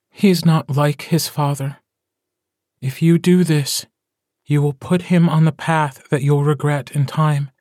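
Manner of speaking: mother's earnest warning to her mate; pleading mother. A narrator's reading.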